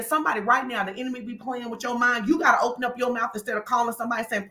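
ordinary talk, nothing marked

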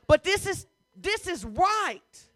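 high, emphatic, stretched-out insistence; insistent preaching. Real, not acted. Preacher defending an unpopular message, insistent preaching. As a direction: drive it home high, drawing out "right".

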